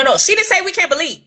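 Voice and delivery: normal talking voice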